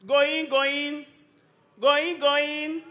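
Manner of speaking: loud, high auctioneer chant; auctioneer countdown. Real, not acted. Auction-style countdown to audience.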